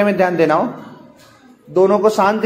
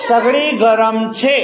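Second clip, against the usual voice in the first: सगड़ी गरम छे read slowly, word by word; slow model reading of Sagri गरम छे, each word held; careful reading; slower than usual. Shown as a demonstration. Teacher showing pupils careful reading, slow and measured. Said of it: "ध्यान से पढ़ो".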